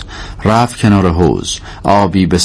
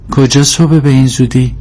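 put-on higher-pitched young girl's question; nosy little girl asking; child's curious question; 6 semitones higher than usual. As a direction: ask in a raised child's voice. Sleepy child questions Karim.

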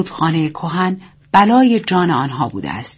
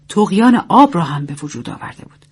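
usual speaking voice

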